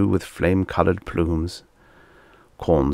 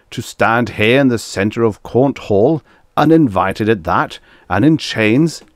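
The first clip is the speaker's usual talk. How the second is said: loud, raised, indignant tirade; storming; 5 semitones higher, 6 dB louder than usual. A narrator's reading. Korn berating the envoy in his hall, storming. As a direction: rage on at full volume. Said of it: "Korn stormed".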